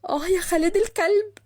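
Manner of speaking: high-pitched frustrated cry; exasperated curse. A narrator's reading. Abandoned woman curses him. (متغاظة وبتشتم)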